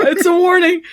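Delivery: high, loud hammed-up cry; mock alarmed shout. Echoing Leo's warning in a hammed-up alarmed yell. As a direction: yell it like a panicked hero.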